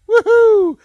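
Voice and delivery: loud high whoop; excited cheer. Real, not acted. Celebrating confirmation.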